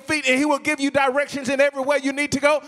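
impassioned pleading, high-pitched and insistent; fervent preaching. Acted. Preacher voicing a struggling believer's plea to God, fervent preaching. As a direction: pile up each plea in a driving preacher's cadence.

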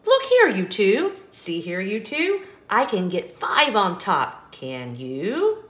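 put-on bouncy storybook reading for a child; lively read-aloud. Parent reading an apple-stacking book to a child, sing-song. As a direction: read it like a bedtime picture book, rhythmic and bright.

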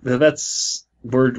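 ordinary talk

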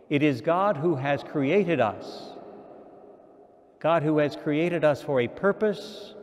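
normal talking voice

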